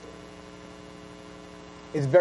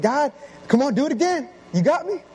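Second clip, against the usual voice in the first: pumped plea; 9 semitones higher than usual. Retold or imitated. Pumped boy pleading with God.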